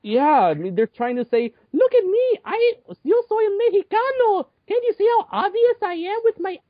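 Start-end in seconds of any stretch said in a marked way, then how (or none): 1.7-6.6 much higher, hammed-up fake Mexican voice; mocking fake Mexican accent. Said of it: "it's clearly fake".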